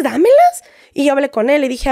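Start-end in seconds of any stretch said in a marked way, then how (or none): 0.0-0.6 sudden high, loud demand; demanding burst. Retold or imitated. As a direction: snap it out to get your accounts back. Demanding her accounts back. (exigiendo sus redes, de golpe y fuerte)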